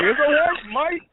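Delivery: high, loud mock yell of protest; imitated screaming. Fleeing wrestler protesting to angry opponent, high loud mock scream. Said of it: "he's laughing his ass off, screaming".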